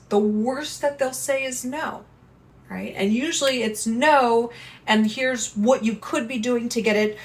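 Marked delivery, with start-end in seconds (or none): none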